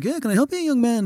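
imitated genteel musician. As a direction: answer a fan courteously. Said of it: "And [name]'s like".

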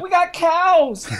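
high slow drawn-out line; movie quote voice. Retold or imitated.

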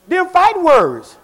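preacher building to a shout; fiery pulpit build to a roar; preaching build. Acted. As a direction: raise it up till you're shouting.

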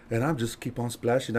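ordinary talk, nothing marked

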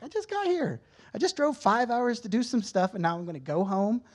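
high-pitched indignant protest, easing down. Retold or imitated. Arguing with the thought of going home, protesting.

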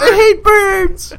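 put-on high, slow shriek of a scared kid. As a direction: wail it like a terrified child.

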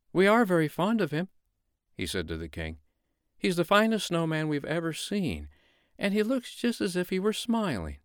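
put-on high earnest boy voice; a boy speaking up for his friend; pleading little boy.

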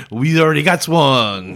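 slow, mock-French sketch voice; Monty Python French accent. Quoting a comedy's Frenchman, slow mock French accent. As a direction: drag it out in a silly French accent. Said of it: "Monty Python. When he's going".

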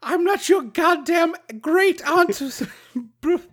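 very high, drawn-out comic voice; hammed-up demanding fairy. Co-host playing a fairy demanding a real kiss, high hammed-up indignation. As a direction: play an outraged fairy, high and slow. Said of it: "and she's like".

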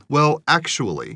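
snarky. Retold or imitated. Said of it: "how snarky it is".

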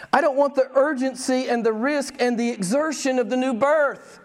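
high-pitched mock reluctance; high put-on voice, shying from any demand; reluctant believer. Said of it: "how does he say it? He says".

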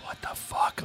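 barely audible whispered reaction; very soft, wary question; hushed disbelief. Retold or imitated. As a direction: whisper it like someone startled awake. Retold night scare, hushed reaction.

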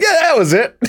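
high, vaguely Scottish mumble; mumbled mock Scottish. Streamer dodging a hard place name, mumbled Scottish. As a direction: fudge it in a mumbled Scottish accent. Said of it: "mumble something that sounds remotely Scottish".

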